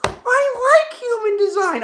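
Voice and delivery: high, loud, drawn-out mimicry; slow, exaggerated mocking squeal; mocking imitation. Reader mimicking fans of human design, high loud mockery.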